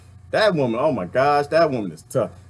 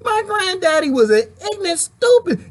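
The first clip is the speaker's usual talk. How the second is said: disgusted grandkid. Pretend. Grandkids look back and scorn their granddaddy, high and disgusted. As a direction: sneer at granddaddy like a disappointed grandkid. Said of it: "Your grandkids gonna look back like".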